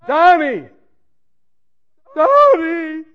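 frantic mother on the phone, calling her son's name and weeping; crying mother calling. Retold or imitated. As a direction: call out to your son through tears. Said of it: "She's crying".

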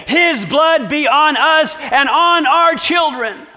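high, loud, slow declaration; the crowd accepts the guilt, calling out; people's answer. Acted.

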